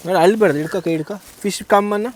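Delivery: calm the little one over her crying. Real, not acted.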